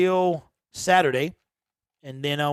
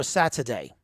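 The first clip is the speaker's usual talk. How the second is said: second, contrasting British take on Saturday; Saturday in the other British accent; 6 semitones lower, 6 dB quieter than usual. Shown as a demonstration. Streamer asking chat to choose, second British accent. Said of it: "the proper one or like the Michael Caine".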